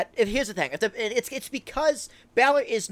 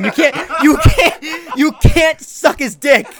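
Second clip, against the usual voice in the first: slow, louder, laughing through the words; laughing scold; 6 semitones higher, 9 dB louder, slower than usual. Real, not acted. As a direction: scold him while cracking up. Laughing while scolding his friend.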